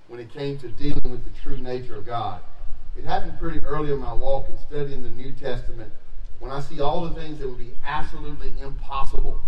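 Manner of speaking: loud, driving preaching voice; forceful preaching. Acted. Pastor to congregation on grace, preaching loud. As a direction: preach it hard to the room.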